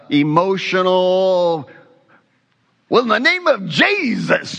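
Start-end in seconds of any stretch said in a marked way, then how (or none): 2.9-4.6 high, hammed-up faith-healer cry; mock an overwrought TV healer; sweating faith healer. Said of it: "like a sweating Benny Hinn type moment".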